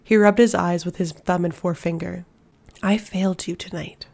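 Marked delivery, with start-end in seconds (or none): 2.8-4.1 unvoiced whispered confession; he whispers his guilt to her. A narrator's reading. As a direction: whisper it into the dark. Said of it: "His words were a whisper".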